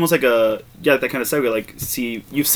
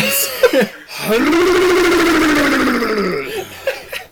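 high-pitched cackling laugh. Real, not acted. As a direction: laugh high after the bit. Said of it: "That's one of my favorites".

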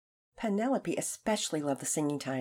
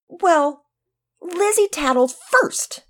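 high, loud, slow whine; a small boy pleading his case; defensive boy; 12 semitones higher, 12 dB louder, much slower than usual. Acted. Boy defends himself to the teacher, high and whiny.

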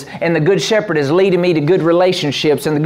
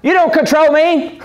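much higher, forceful mock command; spirit man asserting; 8 semitones higher than usual. Preacher voicing the grown spirit man, bossy and high. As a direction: snap back at the flesh. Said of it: "your spirit man says".

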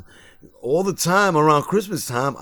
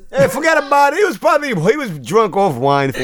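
mock Italian-American; 8 semitones higher than usual. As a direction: play a goomba waving it off, Italian-American style. Mock Italian mobster talk.

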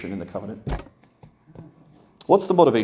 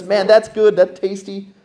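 put-on kid relishing candy; 8 semitones higher, 5 dB louder, slower than usual.

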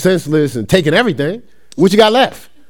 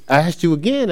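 ordinary talk, nothing marked

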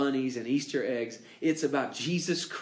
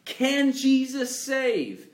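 much higher, louder and slow on Can Jesus save; rhetorical question; 11 semitones higher, 5 dB louder, slower than usual. Shown as a demonstration. Preacher puts the big question to the congregation, high and slow. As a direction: ring out the question slowly.

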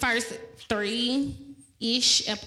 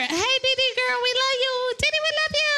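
excited shout-out; 10 semitones higher, much faster than usual. Real, not acted. Calling out to absent friend.

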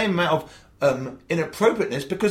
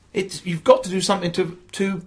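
ordinary talk

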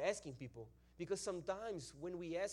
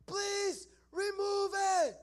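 high, very slow, drawn-out scream; 9 dB louder, much slower than usual. Retold or imitated. Kid across the room begging for removal, screaming. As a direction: scream the plea, stretching every word. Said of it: "starts screaming another thing".

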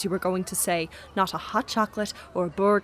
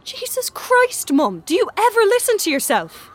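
exasperated; 10 semitones higher than usual. Acted. Son exasperated with mother.

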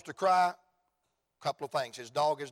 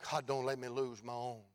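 prayerful plea; 4 semitones lower, 7 dB quieter than usual. Retold or imitated. Prays aloud over own children.

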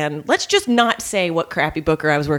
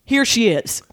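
sarcastic stage announcement, higher; mock announcer; 7 semitones higher than usual. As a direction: introduce her to the crowd with contempt. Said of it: "flings open the kitchen door and goes".

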